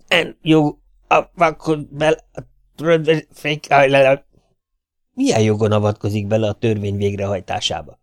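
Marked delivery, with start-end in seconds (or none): 0.1-4.3 high croaking voice of a flu-ridden crow; a mayor who cawks instead of talking; hoarse crow croak. A narrator's reading. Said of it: "influenzás varjuhörrentés".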